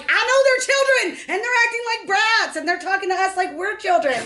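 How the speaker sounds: high, strained yelling back; retold scream. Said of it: "and I'm still screaming".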